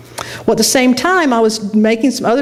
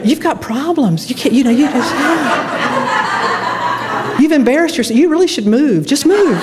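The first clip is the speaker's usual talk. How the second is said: high, laughing scolding self-voice; mocking inner voice; 5 semitones higher than usual. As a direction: tease yourself like a nagging critic. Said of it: "It just went on and on".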